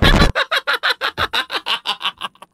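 high, wheezing laugh at his own failure; giggling in disbelief; helpless laugh. Real, not acted. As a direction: laugh it off, caught out. Laughing after being caught.

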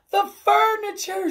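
high, loud cry of dismay. A narrator's reading. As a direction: cry out in high-pitched alarm. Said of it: "she cried".

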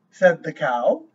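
lively storybook voice, each line set apart; reading to a child. A narrator's reading. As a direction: read it like a bedtime book for a little one. Reads picture book aloud.

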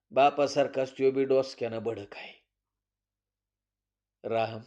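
usual speaking voice

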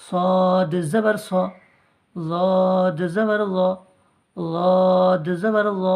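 slow, drawn-out chant of the syllable; recitation drill. Shown as a demonstration. Teacher chants letter drills slowly for the class. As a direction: chant it slowly for students to copy. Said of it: "এগুলো সব আকার".

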